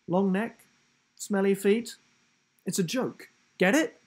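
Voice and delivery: high and loud, pleased with the joke; goofy giraffe voice. A narrator's reading. Giraffe explaining his joke to Will, goofy and eager. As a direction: sell the punchline, eager for a laugh.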